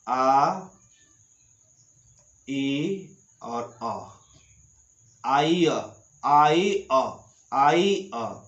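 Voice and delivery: slow, drawn-out आ ई अ, then आई अ blended; teacher sounding out आई अ slowly; spelled-out vowel demo. Shown as a demonstration. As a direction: stretch each vowel like a teacher: आई अ. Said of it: "कैसा उच्चारण करना है?".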